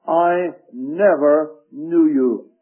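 slow, drawn-out "I never knew you", weight on "never"; stress on never. Shown as a demonstration. Preacher reading Christ's verdict to the congregation, stressing "never". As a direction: lean slowly and firmly on "never". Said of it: "Emphasis on the word never".

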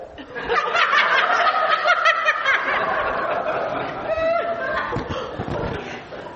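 long squealing high laugh. Real, not acted. Preacher laughing at the boy's grim remark, high laugh.

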